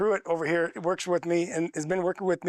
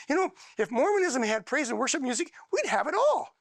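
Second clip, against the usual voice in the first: voicing the friend; 6 semitones higher than usual. Quoting friend's wish.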